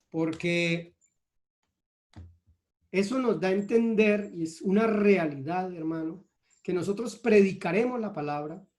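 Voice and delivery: normal talking voice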